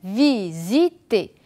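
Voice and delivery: visiter slowly, s said as z; slow careful z. Shown as a demonstration. Teacher modelling for learners, visiter slow with z. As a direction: stretch visiter, buzzing the z. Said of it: "Regardez bien".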